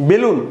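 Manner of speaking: বেলুন with a narrow "e": "belun"; closed e vowel. Shown as a demonstration. Teacher showing students the closed sound, closed e. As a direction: pronounce বেলুন as "be-lun", vowel not opened. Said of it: "বেলুন এর উচ্চারণটা একার".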